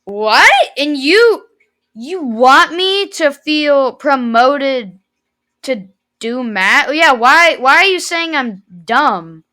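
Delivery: indignant retort. Retold or imitated. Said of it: "I'm like".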